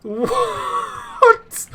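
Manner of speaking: very high, drawn-out squeal of disbelief; shrieking what. Real, not acted. Disbelief at moving models.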